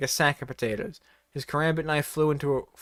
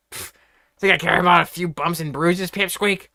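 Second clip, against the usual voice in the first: smirking; 3 semitones higher, 5 dB louder than usual. A narrator's reading. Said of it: "said with a smirk".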